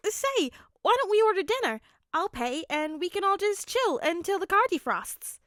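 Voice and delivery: a loud, upbeat dad voice; cheerful host. Acted. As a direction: welcome them in with big cheer. Hizashi proposes ordering dinner.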